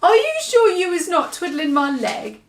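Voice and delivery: high, doubtful giant; half-believing, teasing; hopeful giant voice. Acted. BFG doubting.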